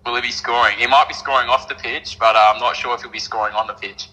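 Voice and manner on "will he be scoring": ordinary talk, nothing marked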